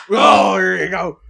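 loud slow yell; shouting. Acted. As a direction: yell it out, slowly. Batman attacks the host.

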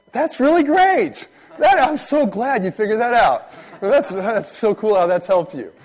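high, loud, hammed-up congratulation; drawn-out, over-bright mock delight; exaggerated enthusiasm. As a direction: gush as if it were news to you. Acted over-enthusiastic reply, comic.

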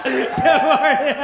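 teasing greeting. Real, not acted. Pastor teases childless churchgoers, slow and laughing.

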